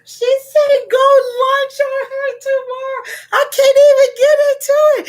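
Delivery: very high, emotional squeal; squealing, close to happy tears; overcome squeal. Real, not acted. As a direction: wail it high, overwhelmed with delight. Overcome by a viewer's gift.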